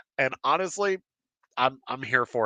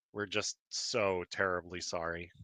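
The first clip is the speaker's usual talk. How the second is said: low, quiet, deadpan mock apology; 5 semitones lower, 10 dB quieter than usual. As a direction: imitate a dry, insincere apology, very softly. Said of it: "Like, deadpan just said".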